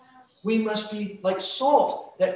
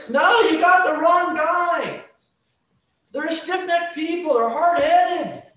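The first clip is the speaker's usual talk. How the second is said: protesting Ezekiel; 8 semitones higher, 6 dB louder than usual. Acted. Ezekiel refusing God, high and loud. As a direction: push back hard as Ezekiel.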